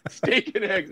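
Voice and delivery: commanding colonel. Retold or imitated. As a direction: hand down the order. Colonel ordering the logistics officer.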